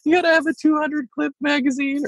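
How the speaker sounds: high, mocking dumb voice; mocking idiot voice. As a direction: ham it up like someone who knows nothing about guns. Mocking a gun-illiterate speaker.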